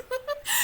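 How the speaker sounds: high squeaky rewind sound; rewind noise. Pretend. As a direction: make a tape rewinding noise. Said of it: "That's my rewind noise".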